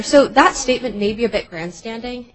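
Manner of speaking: speaking up. Real, not acted. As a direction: say it up for the judge to hear. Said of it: "could you speak up a little".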